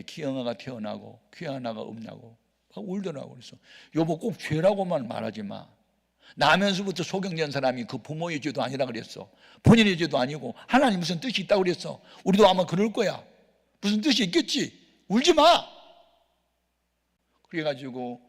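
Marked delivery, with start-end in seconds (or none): 4.0-15.0 reassuring, rising to a louder, higher plea; comforting turns into an insistent loud plea; retold comfort to wife. Pastor comforting his weeping wife. 15.1-15.7 high, loud cry of don't cry; a husband's high loud plea; pleading shout. Retold or imitated. Telling the crying wife to stop.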